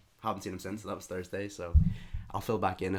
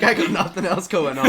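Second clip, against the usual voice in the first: laughing through the words; can't hold back a laugh while telling it; words spilling out mid-laugh; 10 semitones higher, 12 dB louder than usual. Real, not acted. Retelling own reply, laughing.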